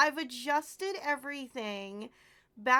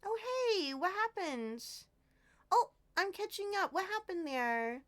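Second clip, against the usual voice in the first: normal talking voice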